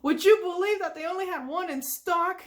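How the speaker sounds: high, loud eager cartoon voice; SpongeBob. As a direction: exclaim it to the crew. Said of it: "And then he says".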